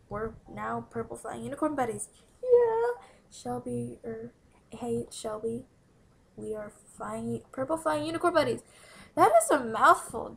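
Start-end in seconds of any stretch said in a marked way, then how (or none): none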